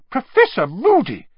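high, loud shocked exclamation. A narrator's reading. McGonagall walks in on the punishment, shocked. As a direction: cry out the name, scandalized. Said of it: "said a shocked voice".

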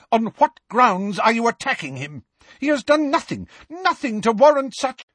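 high-pitched indignant demand; Professor McGonagall. A narrator's reading. Teacher challenging the attackers, indignant demand. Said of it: "said Professor McGonagall's voice".

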